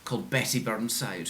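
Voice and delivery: ordinary delivery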